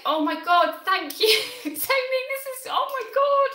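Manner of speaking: very high, loud squealing thanks; excited squeal. Real, not acted. Streamer thanking Tony for a big donation, loud high squeal. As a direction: squeal it out, beside yourself.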